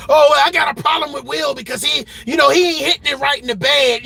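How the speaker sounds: mock yell of a gossiping wife; mocking celebrity quote. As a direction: parody the complaint with a shout. Mocking quote of [name] about [name].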